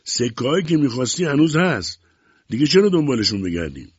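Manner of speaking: loud, high-pitched objection. A narrator's reading. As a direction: call it back loudly to the boss. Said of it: "با صدای بلند گفت".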